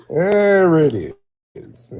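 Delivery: gloating stretched exclamation; triumphant drawn-out call. Real, not acted. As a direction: stretch it out like you just won the bet. Host triumphantly confirms his guess.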